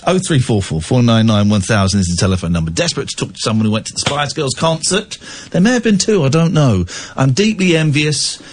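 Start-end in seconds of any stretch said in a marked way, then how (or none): none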